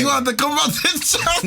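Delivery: high-pitched impression, close to laughing; giggly mock imitation; Tezza. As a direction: repeat a friend's line in a squeaky voice. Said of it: "Tezza, Tezza was like".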